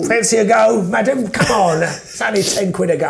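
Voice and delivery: high-pitched market trader's patter; street hawker. Pretend. Hawker offering madam a go, mock market patter. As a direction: coax punters like a fairground barker.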